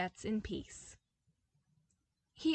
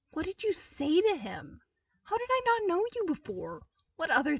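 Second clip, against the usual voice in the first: excited; 9 semitones higher, 6 dB louder than usual. A narrator's reading. Prince thrilled by Cale's trick.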